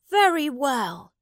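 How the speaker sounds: put-on high young prince granting; gracious boy king. A narrator's reading. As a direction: royal assent from a child. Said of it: "Edward said".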